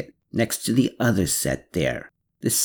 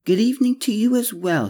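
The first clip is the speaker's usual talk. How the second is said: higher, faster woman's voice; female character voice; 13 semitones higher, faster than usual. A narrator's reading. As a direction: voice Clara light and high.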